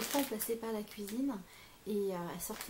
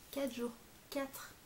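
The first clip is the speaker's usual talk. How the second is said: usual speaking voice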